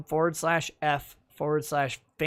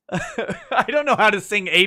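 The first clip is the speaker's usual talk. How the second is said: laughing while talking; 5 semitones higher, 6 dB louder than usual. Real, not acted. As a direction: talk through a laugh.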